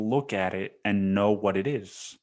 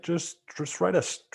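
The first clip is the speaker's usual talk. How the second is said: pitched-up pleading voice; exasperated plea; 9 semitones higher than usual. Retold or imitated. Pleading with pretentious writers, high exasperated plea. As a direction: squeak it out in disbelief.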